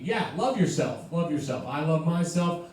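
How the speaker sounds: ordinary talk, nothing marked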